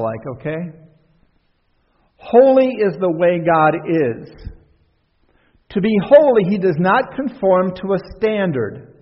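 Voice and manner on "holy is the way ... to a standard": careful reading. Shown as a demonstration. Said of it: "Listen to this real careful".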